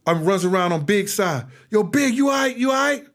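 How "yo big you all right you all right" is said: calling out. Retold or imitated. Calling out to shot friend.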